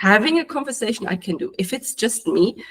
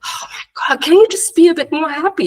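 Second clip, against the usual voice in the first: exasperated self-nag; 9 semitones higher, 5 dB louder than usual. Retold or imitated. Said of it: "I would literally be like".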